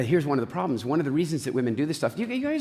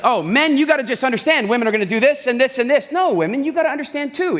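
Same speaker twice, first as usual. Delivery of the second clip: much higher and louder preachy voice; mock lecturing shout; 11 semitones higher, 8 dB louder than usual. Speaker mocking self-help gurus lecturing men and women, loud preachy shout. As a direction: hammer it like a pushy guru.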